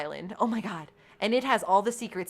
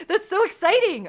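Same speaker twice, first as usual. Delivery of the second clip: excited laugh; 10 semitones higher, 6 dB louder than usual. Real, not acted. Thrilled at new tribe members.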